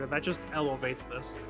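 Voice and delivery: ordinary talk, nothing marked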